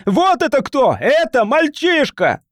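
very high, frantic accusation; crying out. A narrator's reading. Cornered man blaming a boy before the crowd, crying out. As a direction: shout the blame onto someone else. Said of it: "выкрикнул он".

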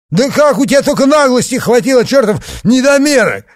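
loud, high outraged bellow; yelling. A narrator's reading. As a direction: roar it at the dwarf. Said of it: "Громче всех вопил Пузырь".

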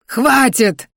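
almost howling. A narrator's reading. Verman begs the attack to stop, almost howling. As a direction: wail it out at the end of your tether. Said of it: "уже практически взвыл Верман".

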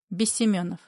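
plain reading voice

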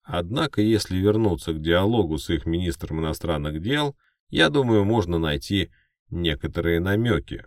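high, loud pundit voice; news anchor. Acted. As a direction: explain it like a TV analyst. Second anchor continues.

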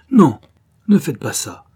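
desperate, very loud high shout; cry out. A narrator's reading. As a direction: scream to stop her sacrifice. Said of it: "et cria". (cri désespéré)

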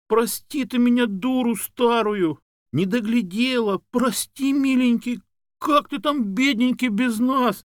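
very high, loud lamenting; Tamara Isaevna. A narrator's reading. Woman begging her dog's forgiveness, wailing tenderly. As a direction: wail apologies at the dog in a shrieky high register. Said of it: "заголосила Тамара Исаевна".

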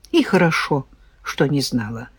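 low, softened sigh of resignation; lightly sighed, dropping in pitch and volume. A narrator's reading. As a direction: let the words sink quietly like a sigh. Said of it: "вздохнула женщина".

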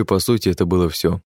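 usual speaking voice

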